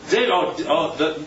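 ordinary talk, nothing marked

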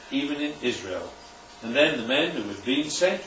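quiet, measured Bible reading; low-key reading of Luke to the congregation; scripture reading. Acted. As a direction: read the passage softly and evenly. Reads scripture passage aloud.

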